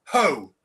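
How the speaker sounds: loud single Ho; ho sound. Shown as a demonstration. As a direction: boom out one Ho. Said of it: "Can you make this noise".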